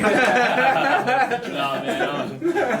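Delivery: high laughing denial. Real, not acted. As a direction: laugh it off, embarrassed. Modest laugh at compliment.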